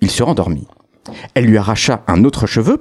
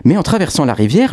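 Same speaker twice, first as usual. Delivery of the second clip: usual speaking voice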